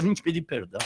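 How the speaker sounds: humble apology. Retold or imitated. Someone at the door asking forgiveness, humbly soft. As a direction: ask pardon meekly. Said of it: "alguém toca lá na porta".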